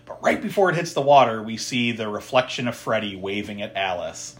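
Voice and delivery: ordinary talk, nothing marked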